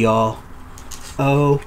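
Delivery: ordinary talk, nothing marked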